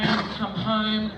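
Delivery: normal talking voice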